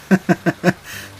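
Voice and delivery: short, high, embarrassed laugh; awkward laugh. Acted. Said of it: "lachte ich unbeholfen". (verlegenes, unbeholfenes Lachen)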